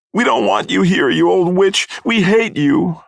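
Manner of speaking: put-on child's high, loud outburst; high, trembling kid. A narrator's reading. Kid lashing out at Missus Grummick, high and trembling. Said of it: "a kid's voice saying, high and kind of trembling".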